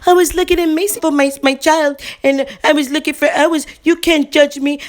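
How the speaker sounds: high, fast, defensive protest; mock her whiny excuses; imitating the mother making excuses. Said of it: "Man, I got hot".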